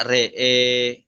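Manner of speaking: RE'E with both vowels as e; imalah at a stop. Shown as a demonstration. Teacher showing how to read when stopping, imalah in RE'E. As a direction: draw out the last e of "re'e". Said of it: "Namun ketika waqaf dibaca".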